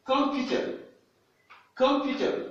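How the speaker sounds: Computer dit haut, à l'anglaise; Computer, syllabe que, voix haute; English model word. Shown as a demonstration. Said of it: "ce n'est pas com, mais que". (Computer à l'anglaise, voix haute)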